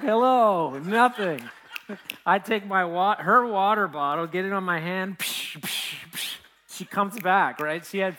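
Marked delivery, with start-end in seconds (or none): 0.0-1.4 louder, higher call to a fainted woman. Retold or imitated. To the woman passed out on his wrist, loud higher call. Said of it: "I'm like". 5.2-6.4 unvoiced psh of splashing water; splash noise. Imitating splashing water on the fainted woman, soft psh sounds.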